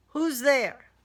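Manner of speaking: loud, high call into the dark house; startled homeowner calling out; man calling out. Acted. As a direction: call out sharply to an intruder. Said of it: "a man called out".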